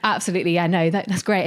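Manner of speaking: ordinary delivery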